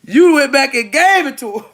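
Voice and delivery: ordinary talk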